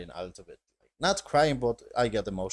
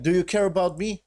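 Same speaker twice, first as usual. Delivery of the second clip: higher, louder imitation of a scream; retold scream; 7 semitones higher, 7 dB louder than usual. Ellie confronting Joel in a retold argument, imitated scream. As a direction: throw the question out as a mock scream. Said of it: "she basically screamed".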